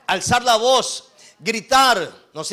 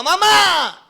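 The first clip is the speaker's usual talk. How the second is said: put-on high, loud cry of a scared child; child calling mom; 9 semitones higher, 4 dB louder than usual. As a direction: yell for mom in alarm. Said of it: "cuando el hijo clama". (niño gritando a su mamá)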